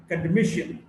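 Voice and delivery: academician as acade-MI-cian; academician, weight on the next-to-last syllable; shifting-stress demo. Shown as a demonstration. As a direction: punch MI in academician. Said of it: "stress shifts depending on the morphological structure".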